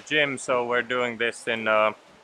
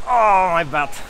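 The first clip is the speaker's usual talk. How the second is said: strained, higher cry; pained groan; 5 semitones higher, 5 dB louder than usual. Real, not acted. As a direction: groan from sore muscles. Muscle pain after jumps.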